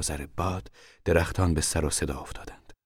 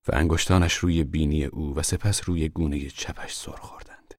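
ordinary delivery, nothing marked

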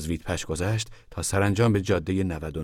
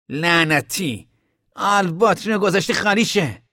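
high, loud angry outburst; loud curse; 12 semitones higher, 6 dB louder, slower than usual. Acted. Tom shouting at Al over the battery, angry. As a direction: snap at Al over the dead battery. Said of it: "تام با صدای بلند گفت".

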